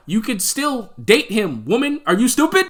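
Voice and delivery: high-pitched, loud mock outrage; incredulous, worked-up rant at a character; exasperated scolding. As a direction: berate her in disbelief, voice jumping up high. Mock scolding the film's Rachel.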